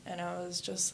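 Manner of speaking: ordinary delivery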